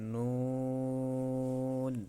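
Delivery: নুন drawn out very slowly; Arabic letter demo. Shown as a demonstration. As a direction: hold নুন long and clear. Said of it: "আরেকবার বলি". (ধীরে টেনে নুন উচ্চারণ)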